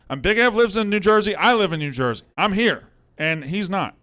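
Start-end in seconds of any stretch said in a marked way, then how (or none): 0.1-2.8 agitated. Real, not acted. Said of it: "I'm just a little agitated".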